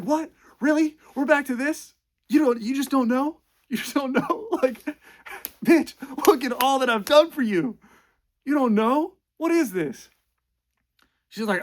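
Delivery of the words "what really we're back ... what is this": loud, high, incredulous ranting; hammed-up fury at an ungrateful girlfriend; exasperated yelling. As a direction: boyfriend yelling, baffled she doesn't know. Noah's outburst at Allie, retold.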